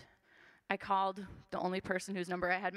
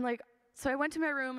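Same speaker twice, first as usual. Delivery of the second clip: normal talking voice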